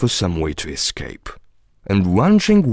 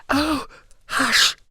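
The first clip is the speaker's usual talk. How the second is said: high, anxious hushing; frightened whisper. A narrator's reading. Rabbit to Alice, afraid of being overheard, frightened hush. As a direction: urgently hush someone in a high, scared voice. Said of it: "whispered in a frightened tone".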